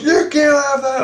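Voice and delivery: high, stretched-out scolding; imitated outraged telling-off. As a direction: forbid it in a high, indignant voice. Acted quarrel over the magazine.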